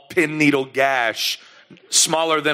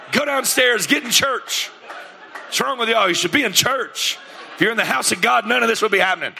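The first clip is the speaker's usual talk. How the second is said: high, half-hammed scolding of the kids; mock scolding yell; 8 semitones higher than usual. Father scolding kids to go to church, raised mock yell. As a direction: preach at them like an upset dad. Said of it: "I'm yelling at all the kids".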